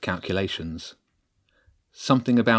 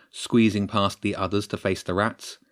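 plain reading voice

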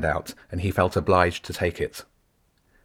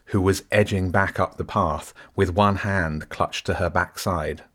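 ordinary delivery, nothing marked

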